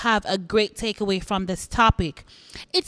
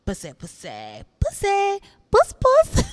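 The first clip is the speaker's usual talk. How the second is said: [name] impression; 4 semitones lower, slower than usual. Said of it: "and she's like".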